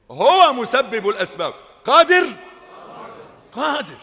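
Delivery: rising sermon peak, loud and sure; booming declaration. Acted. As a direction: thunder it to the congregation. Preacher booming about God's power.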